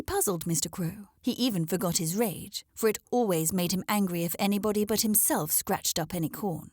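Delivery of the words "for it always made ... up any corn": usual speaking voice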